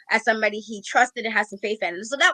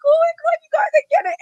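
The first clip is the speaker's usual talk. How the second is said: much higher and louder, a squealing cry; emotional squeal; 8 dB louder, faster than usual. Retold or imitated. Panelist reacting to a crying fan, squealing high and loud. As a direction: squeal it out like you're welling up. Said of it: "I'm sitting here like".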